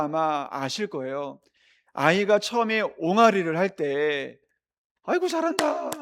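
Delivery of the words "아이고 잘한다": put-on much higher, delighted praise to a baby; doting parent. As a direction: coo at the babbling baby. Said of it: "그러지 않으셨겠습니까". (아기에게 감탄하는 부모 말투)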